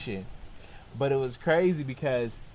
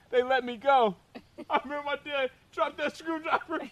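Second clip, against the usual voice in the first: normal talking voice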